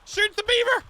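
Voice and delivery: high, frantic urging; urgent shouting. Real, not acted. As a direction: yell at your teammate to shoot. Urging teammate to shoot.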